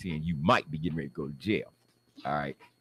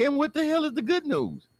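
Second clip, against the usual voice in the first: very high-pitched disbelief; incredulous outburst. Acted. Man demanding the good news after hearing he's arrested, high incredulity.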